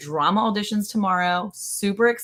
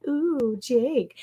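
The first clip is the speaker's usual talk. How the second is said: higher, cooing mock seduction; sweet, seductive; 7 semitones higher than usual. Teasing a wife as seductive with her husband, mock sweet. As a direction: play at sweet-talking a husband. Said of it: "all, like, sweet and seductive".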